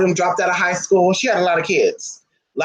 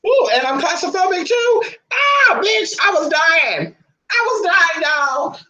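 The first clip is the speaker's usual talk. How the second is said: shrieking excitement; 11 semitones higher, slower than usual. Real, not acted. Recalling corner punishment, shrieking.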